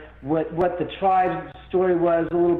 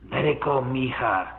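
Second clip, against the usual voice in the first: ghost's voice; 4 semitones lower than usual. Retold or imitated. Dead father on the recorder to his daughter, low and hushed. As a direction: lightly voice the dead father's calm plea. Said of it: "this man's voice, as clear as a bell, said".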